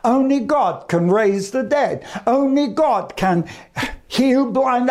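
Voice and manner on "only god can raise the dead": ordinary talk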